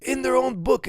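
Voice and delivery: high, loud mocking preacher line; mock preacher quote. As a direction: ham up the preacher's outrage. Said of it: "he's like".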